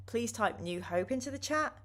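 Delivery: ordinary delivery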